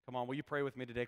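ordinary delivery

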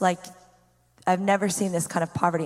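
ordinary delivery

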